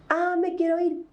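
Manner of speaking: put-on high, whining child's complaint; whiny child. Parent imitating a crying child's high whine. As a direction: mimic a child whining to get its way.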